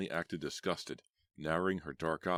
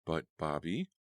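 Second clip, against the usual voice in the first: plain reading voice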